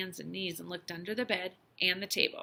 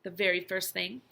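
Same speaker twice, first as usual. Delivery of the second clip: ordinary delivery, nothing marked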